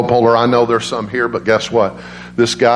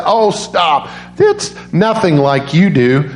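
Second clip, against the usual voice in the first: grandma voice; 6 semitones higher, slower than usual. Retold or imitated.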